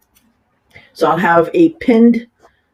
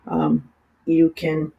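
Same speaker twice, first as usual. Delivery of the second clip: ordinary talk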